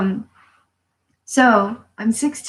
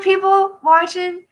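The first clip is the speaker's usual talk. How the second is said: ordinary talk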